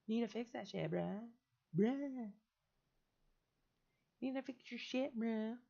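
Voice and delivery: high, bro-speak callout; mock bro voice; imitating bro. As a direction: act the bro telling him off. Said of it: "You should have been like".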